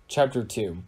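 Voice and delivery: ordinary delivery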